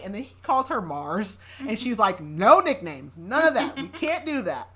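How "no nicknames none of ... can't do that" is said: acted protest, climbing high; mock firm refusal. Woman refusing Theo's nickname in a retelling, firmly protesting. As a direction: lay down the law, pitch rising.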